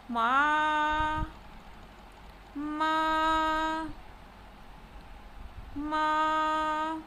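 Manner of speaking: "ma" drawn out on one level pitch; first tone on ma. Shown as a demonstration. Teacher showing the word for mother, "ma" in level tone. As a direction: hold each "ma" steady like a sung note. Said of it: "look at the tonal variations of ma".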